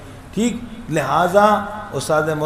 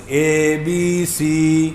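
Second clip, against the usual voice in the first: slow English letters اے بی سی; English ABC; much slower than usual. Shown as a demonstration. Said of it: "English ہی سیکھو نا یار".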